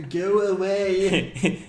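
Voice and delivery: slow, high laughing taunt. Pretend. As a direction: drag it out through laughter. Mock Canadian taunt banter.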